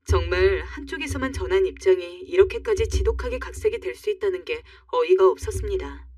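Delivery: ordinary delivery, nothing marked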